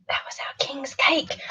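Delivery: whispered hint. Real, not acted. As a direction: lean in and whisper the clue.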